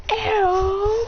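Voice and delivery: high rising call; creature cry. Retold or imitated. Imitates dragon's attack call.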